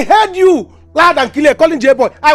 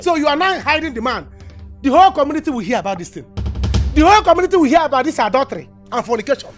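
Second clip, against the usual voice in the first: scolding rant; 6 semitones higher, 4 dB quieter than usual. Acted. Neighbour threatens to expose them.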